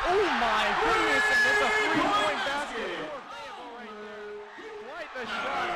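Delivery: loud, slow, high excited call; excited shout. Real, not acted. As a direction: yell it out over a huge shot. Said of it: "Excuse me if this is a little loud".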